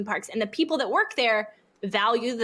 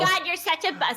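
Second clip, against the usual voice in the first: mock kid scolding; 8 semitones higher than usual. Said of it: "sorry, they can't say God".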